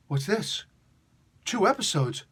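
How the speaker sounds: rising surprised double take. Real, not acted. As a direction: catch the surprise of two at once.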